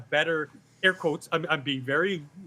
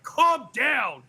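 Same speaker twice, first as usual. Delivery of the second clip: higher and louder, pressing Calm down hard; imitating a congresswoman snapping at a colleague, louder; loud condescending calm down; 7 semitones higher, 6 dB louder than usual. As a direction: third time, now really talking down. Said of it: "And then the third time".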